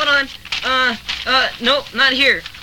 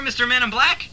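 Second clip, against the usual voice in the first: usual speaking voice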